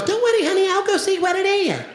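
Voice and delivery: very high put-on wife voice; Israeli wife. Wife going to check noises at home, high mimicking voice. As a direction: imitate her reassurance in a squeaky-high pitch. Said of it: "My wife goes".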